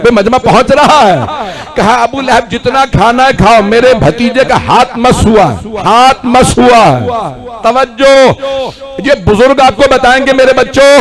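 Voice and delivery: high, loud, impassioned oratory; ringing sermon climax; full-force preaching. Real, not acted.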